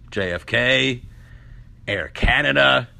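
ordinary talk, nothing marked

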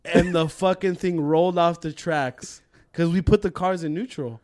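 normal talking voice

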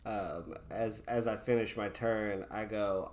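usual speaking voice

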